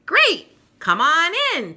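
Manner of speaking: high, loud and eager welcome; voiced Peppermint Patty. Peppermint Patty welcoming Marcy at the door, high and eager. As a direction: throw the door open happily. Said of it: "Peppermint Patty says".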